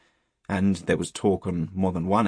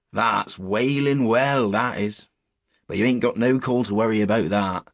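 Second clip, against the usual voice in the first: put-on old country shepherd; 3 semitones higher, 5 dB louder than usual. Shepherd answering boys about the clump, rustic and emphatic.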